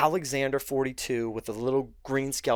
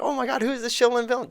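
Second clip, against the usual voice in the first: higher, excited mock gasp; retelling how the listeners reacted, a higher excited mock; mock amazed crowd; 12 semitones higher, 3 dB louder, faster than usual. Quoting amazed audience.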